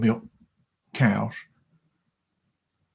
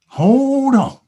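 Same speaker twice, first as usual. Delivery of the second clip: higher and louder 'Hold on' called out; impassioned shout; urgent cry; 11 semitones higher, 6 dB louder than usual. Acted. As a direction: belt the repeat. Cow repeats advice, emphatic.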